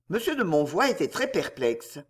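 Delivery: ordinary delivery, nothing marked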